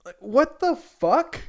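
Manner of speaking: high, disbelieving outburst; real what-the-hell shock; incredulous. Real, not acted. Incredulous at weapon stats.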